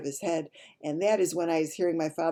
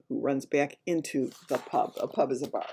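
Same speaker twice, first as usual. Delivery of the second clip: ordinary delivery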